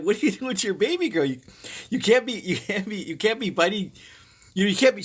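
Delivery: high, exasperated telling-off; retold scolding. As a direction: re-enact scolding the dog in a high voice. Retelling scolding the mother dog.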